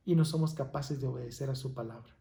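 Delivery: ordinary delivery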